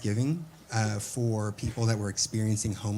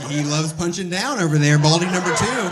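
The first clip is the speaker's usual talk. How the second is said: louder, higher ribbing of a heckler; teasing callout; 6 semitones higher, 9 dB louder than usual. Real, not acted. Comic to bald audience member, loud higher teasing. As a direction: call him out, laughing.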